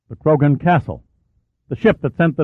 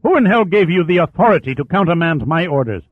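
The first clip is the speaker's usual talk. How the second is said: loud, higher enraged demand; furious roar; 7 semitones higher, 4 dB louder than usual. Acted. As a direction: bellow the challenge like a drunk captain. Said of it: "he roared".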